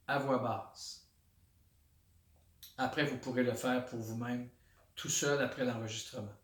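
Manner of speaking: soft, low meditation voice with long pauses; hushed, unhurried body-scan guidance; guided meditation. Shown as a demonstration. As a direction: settle into quiet, centred awareness, naming each sensation softly. Leads a body-scan meditation aloud.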